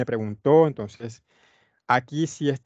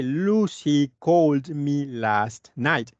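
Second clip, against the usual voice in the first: slow model sentence; much slower than usual. Shown as a demonstration. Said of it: "esta oración dice".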